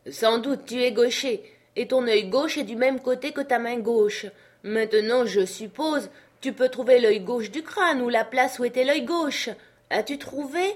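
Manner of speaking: high, loud explanation; impatient master. Acted. Master explains the left eye to Jupiter, impatient. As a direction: spell it out urgently up the tree.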